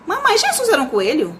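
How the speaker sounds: lightly acted child, high questioning voice; filho perguntando. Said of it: "entre um pai e um filho". (criança perguntando à mãe, voz aguda)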